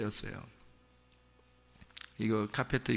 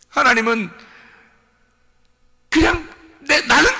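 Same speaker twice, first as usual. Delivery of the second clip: high, loud sermon voice; impassioned preaching; 9 semitones higher, 9 dB louder than usual. Acted. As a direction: pour it out to the congregation. Preaching at full force. (열정적으로 외치는 설교)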